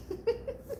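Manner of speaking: soft high laugh; airy giggle. Real, not acted. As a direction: giggle to herself. Laughing at the story.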